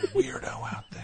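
whisper. Real, not acted. Gossip about staffer nearby.

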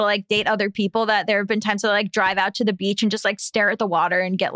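ordinary talk

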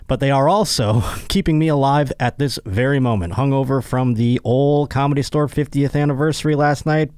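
hyped host ad read. Acted. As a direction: pitch the sponsor like a buddy. Sponsor read for Liquid IV.

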